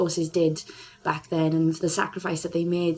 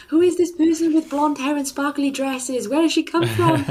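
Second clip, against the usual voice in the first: high, louder, wide-eyed wonder; amazed children; 8 semitones higher, 4 dB louder than usual. Retold or imitated. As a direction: play the dazzled children, voice climbing. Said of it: "And they were amazed".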